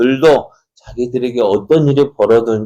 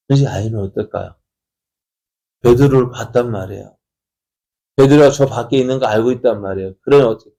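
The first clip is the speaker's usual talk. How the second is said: normal talking voice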